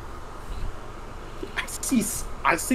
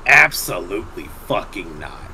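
a low, firm mother's refusal, lower than her usual voice; stern mother; 11 semitones lower, 4 dB quieter than usual. Retold or imitated. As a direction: shut it down like a strict mom. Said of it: "reverted to, like, the, the mother".

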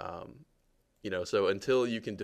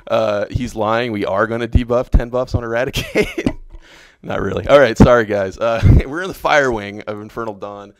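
loud joking protest; 3 semitones higher, 19 dB louder than usual. Real, not acted. Loudly contradicting teammate's denial.

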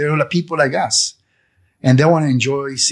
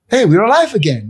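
high, loud happy exclaim; joyful cry; 9 semitones higher, 6 dB louder than usual. Retold or imitated. Voicing the convoy crowd's relief, a loud joyful cry. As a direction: burst out with relief.

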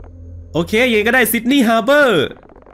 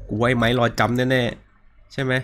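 slow, soft hushed take of กูว่าไอ้ไม้รอจำแน่ๆ; hushed whisper; 8 semitones lower, 6 dB quieter, much slower than usual. Real, not acted. Gamer sneaking toward the bedroom, hushed guess. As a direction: breathe กูว่าไอ้ไม้รอจำแน่ๆ low and slow like someone may hear. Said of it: "อย่าทำเสียงดังนะ".

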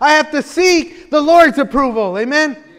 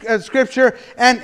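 ordinary talk, nothing marked

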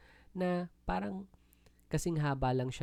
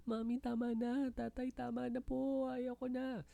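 put-on high, small pleading child's voice; crying child; 14 semitones higher, 5 dB quieter than usual. Preacher voicing a child pleading with mami to stop. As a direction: beg like a child being spanked.

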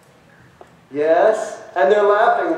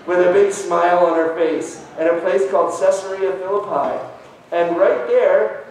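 normal talking voice

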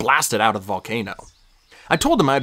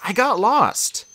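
sheepish Percy; 10 semitones higher, much slower than usual. A narrator's reading. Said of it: "I said".

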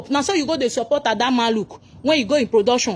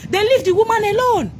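exasperated outburst; 8 semitones higher than usual. Real, not acted. Fan scolding the actress.

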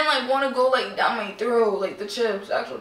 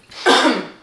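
coughing; 6 dB louder than usual. Real, not acted. Spicy chip stuck.

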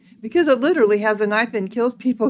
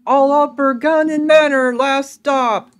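louder, drawn-out station announcement; disembodied conductor's call; 4 semitones higher, 6 dB louder, slower than usual. A narrator's reading. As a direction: call the last stop to all passengers. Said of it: "A disembodied voice calls".

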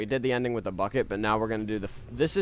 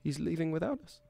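high, heartbroken put-on accent; hammed-up hurt at being abandoned; mock emotional; 6 semitones higher than usual. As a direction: play up the heartbreak in a higher voice. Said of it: "turned on the accent to do my emotional thing".

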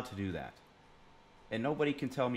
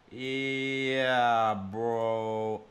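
drawn-out reaction; 3 semitones lower than usual. Acted. Mock reaction while searching clips.

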